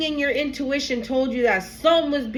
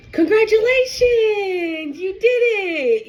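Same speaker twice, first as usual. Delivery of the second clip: high, slow, tearful cheer; real tears breaking through the words; weeping as she congratulates; crying congratulations; 10 semitones higher, 3 dB louder, slower than usual. Real, not acted. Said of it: "so freaking emotional".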